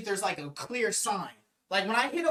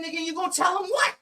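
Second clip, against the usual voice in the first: higher, louder scoffing comeback; defiant challenge; 9 semitones higher, 5 dB louder than usual. Retold or imitated. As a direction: call his bluff loudly and with disbelief. Threatened with cops, challenges back.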